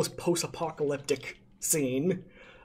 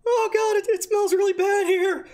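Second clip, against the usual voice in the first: much higher, louder squeaky creature voice; a tiny kobold whining at the stench; 5 dB louder than usual. Acted. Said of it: "the little kobold ... saying".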